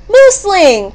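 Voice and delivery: high-pitched exclamation; delighted cry. A narrator's reading. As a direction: cry out the name on spotting a friend. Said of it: "cried Mouse".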